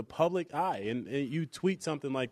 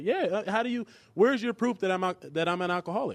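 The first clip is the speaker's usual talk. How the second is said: higher-pitched mock of the indignant mother; a lightly voiced, higher-pitched angry mother; imitating the upset mom; 7 semitones higher than usual. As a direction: voice her outrage, raised and pleading. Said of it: "his mom would be upset".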